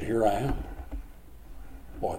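ordinary talk

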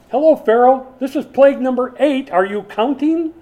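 loud, high-pitched sarcastic hailing; exasperated shout to get Pharaoh's attention; incredulous mock call. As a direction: call out as if to someone dense.

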